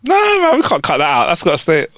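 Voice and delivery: high, excited protest. Real, not acted. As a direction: pitch up sharply in mock outrage. Refusing to cut the bit.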